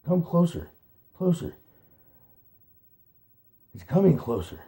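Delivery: high, frightened whimper; whimpering with fear. A narrator's reading. Fiver to Hazel, high fearful whimper. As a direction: whimper it in rising dread. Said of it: "He began to whimper with fear".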